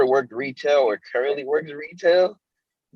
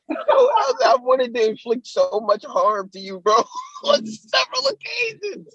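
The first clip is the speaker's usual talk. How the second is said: laughing; 10 semitones higher than usual. Real, not acted. Cracks up confessing urge to hurt friend.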